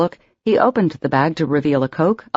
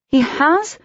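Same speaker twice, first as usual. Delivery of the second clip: surprised; 11 semitones higher than usual. A narrator's reading. Surprised delight hearing Patrick.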